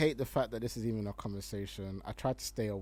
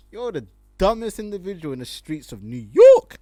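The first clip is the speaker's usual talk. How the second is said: loud rant; 6 semitones higher, 16 dB louder than usual. Real, not acted. Ranting at a tweeter.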